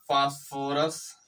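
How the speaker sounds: Phosphorus very slow, each syllable held, rising at the end; slow dictation. Shown as a demonstration. Teacher telling students to write the name, slow dictation. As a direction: stretch phosphorus out for note-takers.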